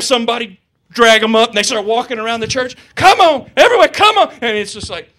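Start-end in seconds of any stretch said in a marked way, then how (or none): 2.9-4.3 high, loud call to join in; rally the crowd to march; loud, eager come-on call. Retold or imitated. Members pulling people into march.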